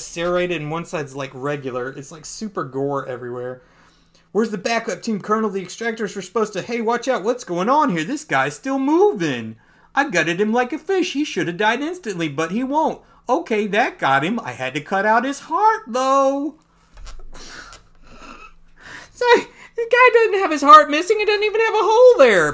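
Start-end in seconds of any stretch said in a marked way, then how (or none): 4.3-16.5 higher, urgent soldier in battle; tense soldier. Acted. Soldier reports mid-fight. 17.3-22.5 much higher and louder, laughing. Real, not acted. Laughing at plot hole.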